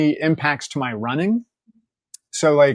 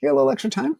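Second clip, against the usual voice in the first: cheeky request; 11 semitones higher, much faster than usual. Retold or imitated. Asking sonographer a cheeky favour.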